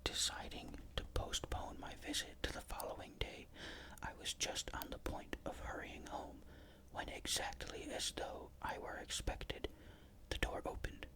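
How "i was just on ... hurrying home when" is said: ordinary delivery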